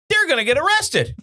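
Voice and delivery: high-pitched, worked-up rant; ranting high at normal volume; excited comic rant. Real, not acted. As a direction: pitch soars in comic indignation. Hammed-up rant about Mardi Gras.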